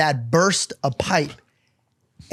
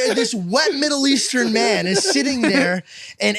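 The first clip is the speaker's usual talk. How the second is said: ordinary talk, nothing marked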